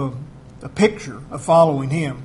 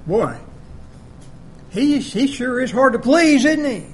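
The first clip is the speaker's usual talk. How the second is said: whiny doubter, pitched up; mock complaint; 5 semitones higher than usual. Preacher mocking a doubter's whiny complaint. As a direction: mimic a grumbling reader. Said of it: "some might read that and say".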